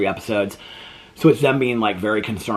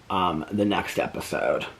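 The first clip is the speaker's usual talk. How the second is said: ordinary talk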